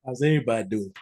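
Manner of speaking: lightly put-on Southern accent greeting; a hint of Southern accent, friendly; fake Southern accent. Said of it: "That's my fake Southern accent".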